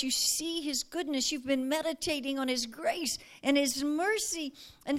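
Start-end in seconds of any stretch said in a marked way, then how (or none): none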